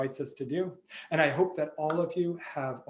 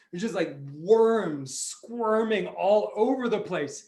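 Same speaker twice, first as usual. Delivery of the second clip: plain reading voice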